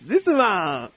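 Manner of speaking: high, sing-song teacher voice; lesson voice. Pretend. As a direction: explain it like a kids' lesson. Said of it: "レッスンワンいきます。".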